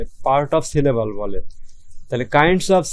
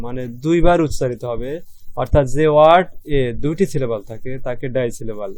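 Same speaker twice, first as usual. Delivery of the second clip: ordinary delivery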